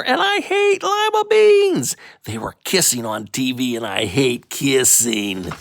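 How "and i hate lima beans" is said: high whining complaint; whiny disgust. Acted. Boy at dinner, high whiny disgust. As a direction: whine the hate way up high.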